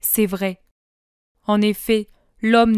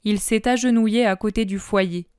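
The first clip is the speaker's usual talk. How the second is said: plain reading voice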